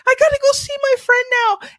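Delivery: put-on high little-girl calling. Little girl at church looking for her friend. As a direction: search eagerly like a small child. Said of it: "they're like".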